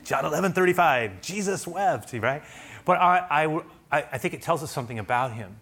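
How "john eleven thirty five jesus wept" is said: higher-pitched Sunday school recitation; boy reciting. Retold or imitated. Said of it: "memorized as a boy in Sunday school".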